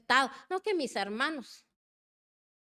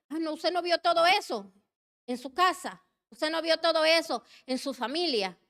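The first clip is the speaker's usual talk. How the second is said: mock denial; 6 semitones higher, 4 dB louder than usual. Preacher voices a denying listener.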